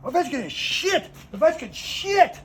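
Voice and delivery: hammed-up furious Russian officer; angry Russian rant. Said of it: "he's like".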